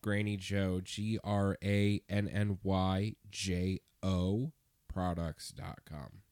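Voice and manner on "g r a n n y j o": slow letter-by-letter spelling; spelled out slowly. Shown as a demonstration. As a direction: spell each letter out clearly. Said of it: "spelling it out".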